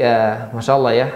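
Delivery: ordinary talk, nothing marked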